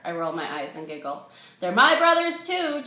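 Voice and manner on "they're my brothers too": high, loud indignant shout; yell. A narrator's reading. Sister yells at her brother on the phone, indignant. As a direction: shout it back at him. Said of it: "Jules yells at him".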